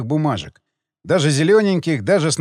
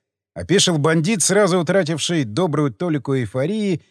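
ordinary delivery

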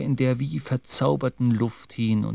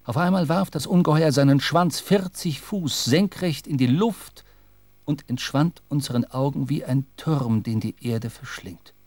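ordinary delivery

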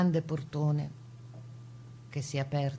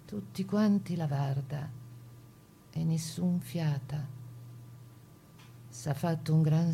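measured, solemn dialect verse; poem recitation. Acted. Reciting a Venetian-dialect poem about a deportee, solemn. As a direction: recite it slow and grave, like a lament.

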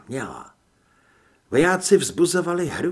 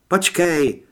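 loud, higher-pitched shout; urgent cry; 5 semitones higher, 8 dB louder than usual. A narrator's reading. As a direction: cry out to stop him. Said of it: "vykřikla Heather". (naléhavý výkřik)

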